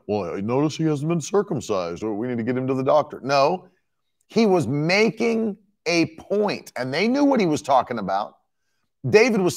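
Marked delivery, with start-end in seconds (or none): 0.1-3.2 low, rushed mock-concerned voice; throwaway low mocking aside; mock naive bystander. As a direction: play a clueless onlooker, low and hurried. Mocks a literal reading.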